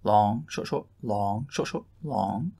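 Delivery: long short syllables chanted with rising tune; scanning the pattern aloud; rhythm recited. Shown as a demonstration. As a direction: chant the metre, leaning on each long.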